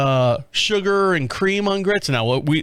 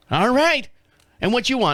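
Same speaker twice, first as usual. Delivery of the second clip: waitress voice; 9 semitones higher than usual. Retold or imitated. Voicing the waitress.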